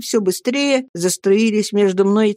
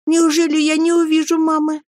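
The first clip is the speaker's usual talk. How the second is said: put-on high-pitched plaintive boy; distressed child; 9 semitones higher than usual. A narrator's reading. Boy afraid he will not see mother, plaintive high question. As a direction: plead in a small high voice.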